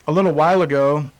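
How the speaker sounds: ordinary delivery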